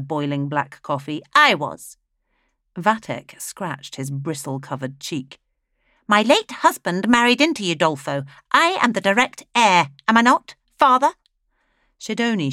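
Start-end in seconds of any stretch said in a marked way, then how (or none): 1.3-2.0 loud, curt claim; a proud widow staking her claim. Acted. Flaminea claims heirship. 6.1-11.1 much higher and louder, haughty and insistent; a grasping widow demanding agreement. Acted. Flaminea presses her claim.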